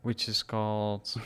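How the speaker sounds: slow, drawn-out lead-in; slow cue. Acted. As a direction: leave it hanging for the partner.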